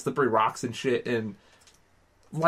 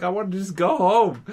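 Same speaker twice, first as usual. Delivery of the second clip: higher, mock crybaby whine; whiny complaining; 5 semitones higher than usual. Crybaby friend wanting out of the river, mock whiny complaint. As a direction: imitate a kid whining to be taken home. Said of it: "he starts complaining".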